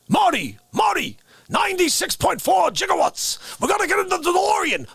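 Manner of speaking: high, frantic shouting Doc; Doc Brown. Retold or imitated. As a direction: cry out in a wild panic, pitch way up. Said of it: "Oh, Doc Green".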